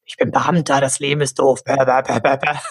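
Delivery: grumpy official. Retold or imitated. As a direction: grumble like a bored civil servant. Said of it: "Es war so dieses typische so".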